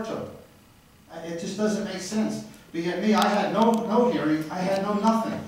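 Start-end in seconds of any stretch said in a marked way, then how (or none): 2.7-5.4 loud, hammering each no; real angry protest. Real, not acted. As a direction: shout out the injustice. Protesting he got no hearing.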